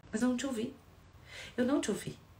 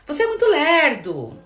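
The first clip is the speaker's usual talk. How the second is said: loud, higher accusing yell; accusing shout; 7 semitones higher, 13 dB louder than usual. Shown as a demonstration. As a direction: snap the insult at your partner. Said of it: "Eu estou apontando".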